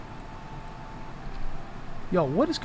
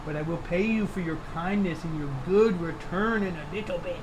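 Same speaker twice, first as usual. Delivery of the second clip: doting pet talk; 2 semitones higher than usual. Real, not acted. Owner promising sweet pup a park trip, doting baby talk.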